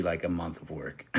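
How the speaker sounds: ordinary talk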